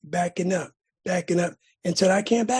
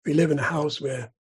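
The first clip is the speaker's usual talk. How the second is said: plain reading voice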